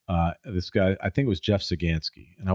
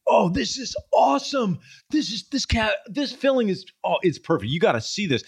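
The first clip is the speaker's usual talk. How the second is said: much higher, gushing over a perfect filling; enthusiastic dentist; 13 semitones higher, 3 dB louder than usual. Retold or imitated. Dentist gushing to his patient about a filling, high excited voice. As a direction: imitate a dentist thrilled by his own work. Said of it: "He was so enthusiastic".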